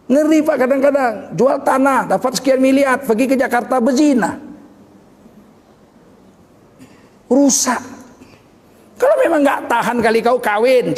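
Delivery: preacher at full force; fiery preaching. Acted. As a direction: scold the congregation from the pulpit.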